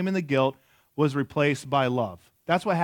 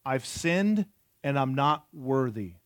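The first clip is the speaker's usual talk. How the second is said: slow, deliberate; 2 semitones lower, slower than usual. Shown as a demonstration. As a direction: let each word of "not worthy" land, slow. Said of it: "repeated slowly as the point".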